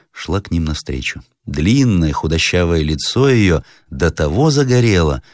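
plain reading voice

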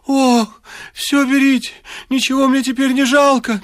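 high, wailing widow; lightly acted high lament. A narrator's reading. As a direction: wail it out in despair. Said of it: "причитала чувствительная вдова".

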